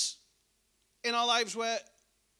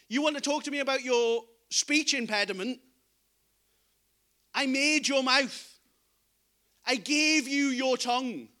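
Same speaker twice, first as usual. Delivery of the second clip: louder, stressing each claim; a God reminding Moses who made him; emphatic divine rebuke; 2 semitones higher, 4 dB louder than usual. Retold or imitated. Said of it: "God goes".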